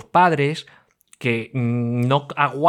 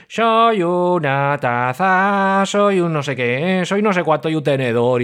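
higher, very slow cartoon sing-song; kids' video voice; 4 semitones higher, slower than usual. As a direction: sound like a children's tablet show. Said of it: "y de repente escuchas".

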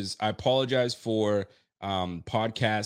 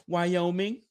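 higher, coy one-word answer; mock girly reply; 7 semitones higher, 3 dB quieter than usual. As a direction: toss out the hometown like a shy girl. Answering as the female Josh.

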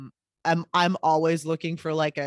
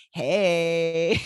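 chill hey; 4 dB louder than usual. Shown as a demonstration. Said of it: "sometimes it'll be in a weird voice".

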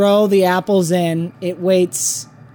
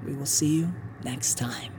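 soft whispered goodbye; whispered sign-off; 5 semitones lower, 15 dB quieter than usual. Acted. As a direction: breathe the farewell.

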